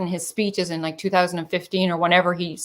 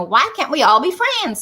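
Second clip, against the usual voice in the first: put-on higher, sing-song Southern accent; 7 semitones higher than usual. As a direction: imitate a sweet Southern girl asking. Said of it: "with a Southern accent saying".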